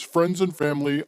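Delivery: ordinary delivery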